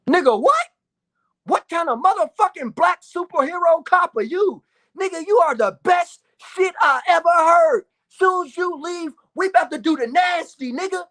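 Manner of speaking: high, loud gleeful disbelief; thrilled at the dad leaving; excited. Real, not acted. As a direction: hype it up. Amazed excited reaction.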